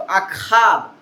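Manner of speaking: ordinary talk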